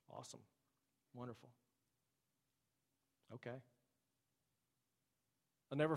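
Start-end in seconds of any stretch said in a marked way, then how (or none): 0.1-3.6 very soft, flat-sounding mock enthusiasm; unimpressed shrug. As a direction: say it like good news leaves you cold. Numb hearers shrugging off revival news.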